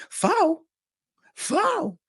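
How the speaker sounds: high, stunned squeak imitating another inmate; incredulous high-pitched echo; mock disbelief. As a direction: mimic a guy hearing bad news. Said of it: "I hear dude say".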